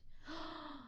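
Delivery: quiet sharp intake of breath; soft shocked gasp. Real, not acted. Hearing assassination plot in game.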